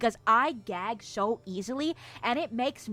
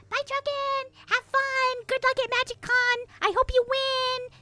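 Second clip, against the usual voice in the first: much higher, bright sing-song farewell; cheery goodbye. Real, not acted. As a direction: send him off with a chirpy high goodbye. Cheery goodbye to departing viewer.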